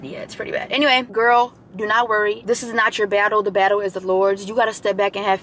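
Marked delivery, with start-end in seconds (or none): 0.0-0.7 much softer, whispered aside; hushed aside. Real, not acted. Aside to viewers about the crash, whispered. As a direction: lower it to a whisper.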